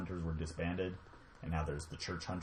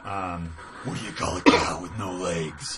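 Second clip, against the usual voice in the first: Batman voice; 2 semitones higher, 10 dB louder, slower than usual. Acted. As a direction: growl it like Batman. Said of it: "Do it in the Batman voice".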